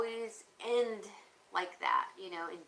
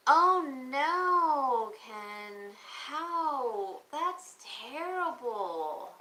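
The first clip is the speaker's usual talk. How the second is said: shocked dismay; 4 semitones higher, 6 dB louder, much slower than usual. Real, not acted. Hearing the caller's bad news.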